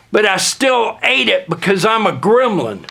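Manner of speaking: ordinary talk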